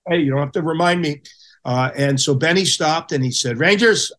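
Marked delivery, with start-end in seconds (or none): 3.6-4.1 rallying call. Retold or imitated. Imitating Benny addressing the team.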